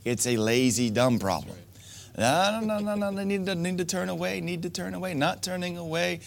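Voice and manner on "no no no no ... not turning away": mocking whine. Mocking men who won't turn away.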